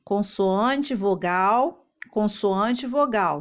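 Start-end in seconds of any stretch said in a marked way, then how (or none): none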